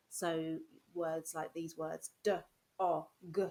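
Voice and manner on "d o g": robot sounding out. Shown as a demonstration. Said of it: "the robot arms".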